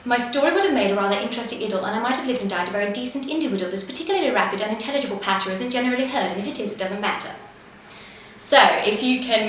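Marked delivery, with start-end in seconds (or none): 0.1-7.3 fast, crisp patter with every consonant sharp; rapid patter demo. Shown as a demonstration. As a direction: rattle it off fast but keep each word clear. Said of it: "So it goes something like this".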